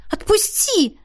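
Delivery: high panicked cry to let go; frightened cry. Acted. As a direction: scream it out in sudden fear. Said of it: "воскликнула".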